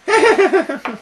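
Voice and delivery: high, loud staccato giggle; mock giggle. Trying to say what the opera's about, high mock giggling. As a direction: imitate a shrill burst of helpless giggles.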